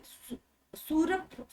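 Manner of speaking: सु-, सूर्प- drawn out slowly and softly; slow soft syllable demo. Shown as a demonstration. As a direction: sound out सूर्प slowly, piece by piece. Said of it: "ये वाला सु".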